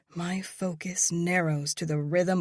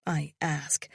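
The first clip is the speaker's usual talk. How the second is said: ordinary delivery, nothing marked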